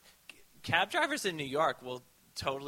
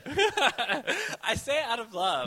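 high, laughing tease; 13 semitones higher, 8 dB louder, slower than usual. Real, not acted. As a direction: giggle through it. Joking affection after insult.

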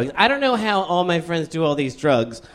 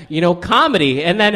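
ordinary delivery

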